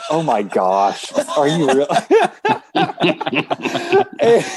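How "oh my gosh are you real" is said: slow, amazed and laughing through it; laughing quote. Retold or imitated. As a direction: play Amy cracking up in disbelief. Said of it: "she immediately says".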